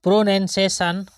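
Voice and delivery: ordinary talk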